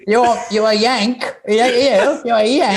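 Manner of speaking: high-pitched mock Kiwi voice; horrible New Zealand accent. Kiwi woman spotting an American, horrible New Zealand accent. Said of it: "like, New Zealand and has, like, a fucking horrible accent".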